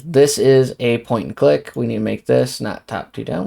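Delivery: ordinary talk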